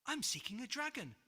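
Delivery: startled, gasping declaration; surprised gasp. Acted. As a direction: caught off guard, rushing the words. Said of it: "gasped George in surprise".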